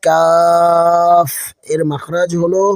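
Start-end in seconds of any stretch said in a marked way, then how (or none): 0.1-1.3 কাফ stretched long, thin and light, chanted; কাফ long and thin, a touch louder; thin light Qaf. Shown as a demonstration. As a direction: hold কাফ out thin like Quran recitation. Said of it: "ছোট এবং পাতলা করে উচ্চারণ".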